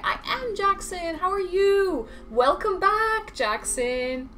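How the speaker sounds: high-pitched effusive welcome; excited greeting. Real, not acted. Streamer welcomes back a returning viewer, excited greeting.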